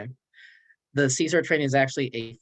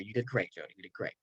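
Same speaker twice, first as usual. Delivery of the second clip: soft praise; 2 semitones lower, 8 dB quieter than usual. Pretend. Softly praising Jody.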